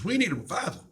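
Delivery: high, put-on voice of a cowboy; cowboy sound guy. Cowboy sound guy telling the host, imitated in a high put-on voice. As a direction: toss off the line like a drawling Texan sound man. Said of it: "this cowboy sound guy turns to me and says".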